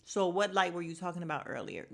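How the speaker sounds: normal talking voice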